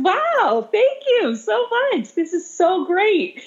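high, slow, sugary mock praise; teacher sarcastically praising a student's banned project, gushing high and slow; mock delight. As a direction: gush with fake delight at the broken rules.

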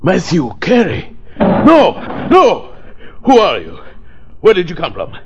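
high-pitched panicked cries of refusal; horrified alarm. Acted. Psychic doctor shocked by the name Matthew Carey, panicked outcry.

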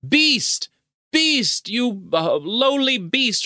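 high, hammed-up shriek of beast; mock screaming woman. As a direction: screech it like a melodramatic lady from a window. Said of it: "who screamed".

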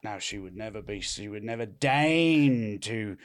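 low, soft, haughty fancy English; quiet upper-class English snobbery. Acted. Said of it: "Is this fancy English".

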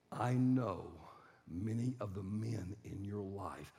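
slow, low, hushed empathy; soft, measured compassion; calm mother. Retold or imitated. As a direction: speak gently to a hurting husband. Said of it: "the mom just very calmly steps in front of him".

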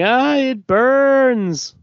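mock anguish, dragged out and loud; agonized groan. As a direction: draw out the burning agony like a hammy hero. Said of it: "and Apollo's saying".

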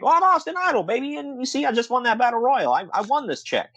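high, cocky wrestler brag; Austin Idol. Retold or imitated. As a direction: strut it like a boastful heel. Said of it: "He's like".

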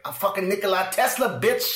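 high, swaggering gangster voice; gangster Tesla. Retold or imitated. Host voicing Tesla threatening the hotel clerk, gangster swagger. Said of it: "I went gangster with it".

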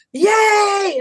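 high mock cheer; imitated crowd cheer. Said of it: "I hear".

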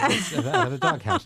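laughing as the pitch jumps high; host laughing while teasing Manny. Real, not acted. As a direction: tease through laughter.

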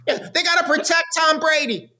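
put-on elf-like kid pundit voice. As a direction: chirp it like a little elf. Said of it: "I think that's an elf voice".

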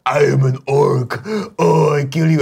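slow, high put-on orc. Mocking the orcs' backstory to co-hosts, hammed-up orc voice. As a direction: play a drawn-out orc threatening to kill. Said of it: "backstory of the orcs".

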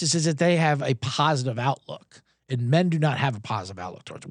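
ordinary talk

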